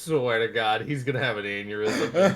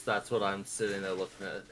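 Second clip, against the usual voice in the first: normal talking voice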